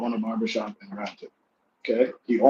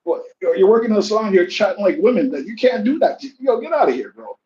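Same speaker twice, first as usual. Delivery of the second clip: mock him for chatting like women; heated telling off; 7 semitones higher than usual. Mocking the barber for gossiping, high and scornful.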